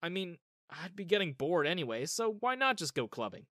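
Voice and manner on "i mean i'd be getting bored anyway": usual speaking voice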